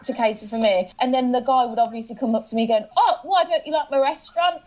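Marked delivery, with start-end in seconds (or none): 3.0-4.6 high mock-hurt restaurant owner; pleading owner.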